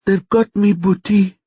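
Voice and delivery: high, frightened phone voice; scared, pitched well up; fearful. A narrator's reading. Captive sister tells her brother, afraid. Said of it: "There was fear in her voice".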